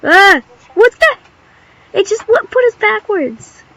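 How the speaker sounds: high, loud startled cry; shocked shriek. Real, not acted. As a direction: yelp in real shock. Game suddenly throws them backwards.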